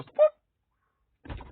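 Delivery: very high whoop sound; dog ears popping up. Acted.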